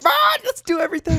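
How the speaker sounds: very high rushed squeal; overexcited cat. Retold or imitated. Overwhelmed cat rushing everything, high and fast. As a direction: play a cat too excited to choose. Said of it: "He's just overwhelmed".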